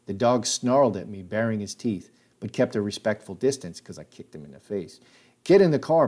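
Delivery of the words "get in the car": high, loud barked order; retold scream. Hogg ordering the boy into the car, loud yell. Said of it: "Hogg screamed".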